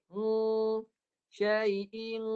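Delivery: held nasal "Hmm", then "Syai'in" ending in the same hum; back-of-tongue dengung. Shown as a demonstration. Teacher isolates the hum for students, held and level. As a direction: sustain the ng that closes "Syai'in". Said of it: "pangkal lidah naik".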